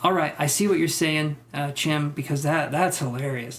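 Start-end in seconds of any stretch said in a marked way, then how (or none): none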